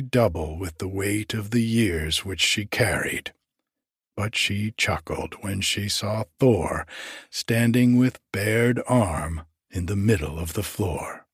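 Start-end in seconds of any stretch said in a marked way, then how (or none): none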